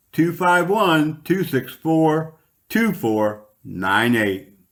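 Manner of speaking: ad read. Acted. Radio host pitching first aid classes to listeners, upbeat ad read. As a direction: sell it like a local radio spot.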